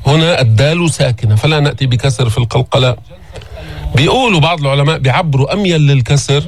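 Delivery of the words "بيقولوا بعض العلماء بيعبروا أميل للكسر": normal talking voice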